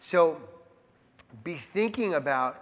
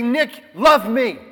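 louder, higher, slow demand; mock yell; 5 semitones higher, 8 dB louder, slower than usual. Preacher showing he can demand love from Nick, mock yell. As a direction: call out a slow, raised-voice plea. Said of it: "I can yell at him".

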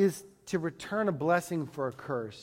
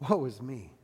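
soft, low sighing woe is me; humbled sigh; 6 semitones lower, 5 dB quieter than usual. Retold or imitated. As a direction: sigh it out, crushed before the Lord. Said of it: "you're like".

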